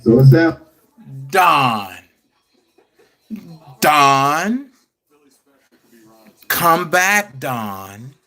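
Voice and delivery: low, drawn-out pleading on each name; Gayle King voice. Acted. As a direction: call out in a deep, measured interviewer's voice, letting the name sink. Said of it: "In my Gayle King voice".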